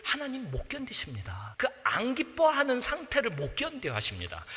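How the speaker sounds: soft unvoiced whisper; hushed preaching whisper. Real, not acted. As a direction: whisper it tenderly. Preacher whispering tender point.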